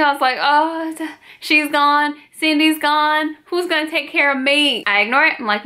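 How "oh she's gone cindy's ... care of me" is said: mock panic. Panicked inner voice.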